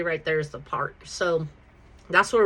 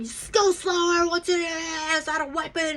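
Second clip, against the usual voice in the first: higher mock cop yell; imitating a yelling cop; 9 semitones higher than usual. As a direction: bark it like a jumpy cop, pitched up. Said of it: "yelling at me".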